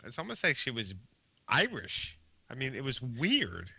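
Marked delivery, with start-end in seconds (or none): none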